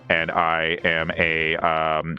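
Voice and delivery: low, monotone cyborg voice; measured low drone, stalling on the ums; Punk Cyborg. Acted. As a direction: impersonate a listener as a deadpan robot man. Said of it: "Could you do my voice".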